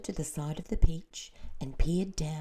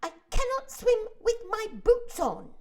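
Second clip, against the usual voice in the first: centipede shouting; 9 dB louder than usual. A narrator's reading. The centipede yells to be freed of his boots, loud. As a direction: yell it in a frantic hurry. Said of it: "shouted the centipede".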